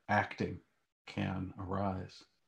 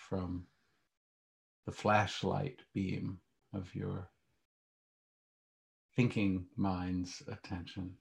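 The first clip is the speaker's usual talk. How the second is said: calm grounding exercise; 4 dB quieter than usual. Acted. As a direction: guide them gently into stillness. Leads a guided meditation.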